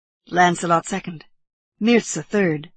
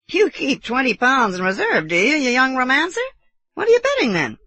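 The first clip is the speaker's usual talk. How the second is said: higher, louder incredulous uncle; amused teasing uncle; 8 semitones higher, 6 dB louder than usual. Acted. Uncle teasing nephew over his savings, amused disbelief. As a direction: tease the youngster with swooping pitch.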